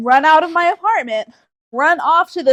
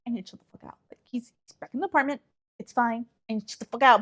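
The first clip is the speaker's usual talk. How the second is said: quiet self-talk; 7 dB quieter, much faster than usual. Retold or imitated. Calming herself inner voice.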